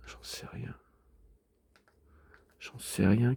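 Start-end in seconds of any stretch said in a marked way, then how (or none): 0.1-0.7 barely audible whisper; whispered shrug. Real, not acted. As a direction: breathe it out, no voice. Whispered then repeated aloud.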